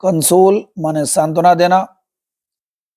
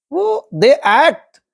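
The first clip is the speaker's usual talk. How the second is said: slow, raised read-aloud; 7 semitones higher, much slower than usual. Shown as a demonstration. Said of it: "teacher stresses the reading".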